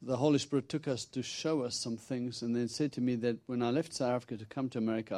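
ordinary talk, nothing marked

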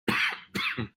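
throat clearing. Real, not acted. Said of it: "Terribly sorry about that".

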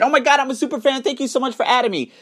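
high, fast excited fangirl voice; gushing fan. Retold or imitated. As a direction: squeal it like a thrilled super fan. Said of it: "wall posted me and said".

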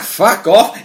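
loud mock yell of fuck off; retold yell. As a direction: bark it back like the driver pulling away. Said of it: "He's like".

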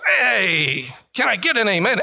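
shouted call for an amen; rally the congregation. Real, not acted. Preacher calling for response.

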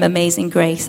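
ordinary talk, nothing marked

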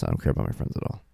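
very low, rushed mimicked mumble; quick, voiceless low retold grumble; sullen daughter. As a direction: imitate a kid grumbling indifference.

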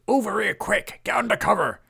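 high, loud shout to take cover; urgent call. A narrator's reading. Beef calls the friends over to shelter under the bar. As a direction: wave them in with a shout.

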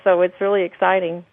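ordinary talk

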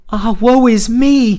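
slow, high, loud lament; bitter cry. Acted. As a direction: wail it out in grief, drawn out. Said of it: "he cried bitterly".

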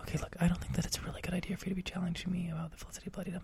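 whispered aside; private word. Pretend. Host whispers a private complaint to his cohost, hushed. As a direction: pull him aside and whisper it so the audience can't hear. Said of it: "can you step into my office?".

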